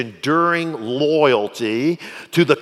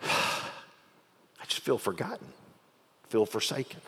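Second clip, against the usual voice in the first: soft, downcast murmur after a sigh; acted weary, deflated complaint; sighing lament; 12 dB quieter than usual. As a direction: say it quietly, as if forsaken. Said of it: "it starts with a sigh".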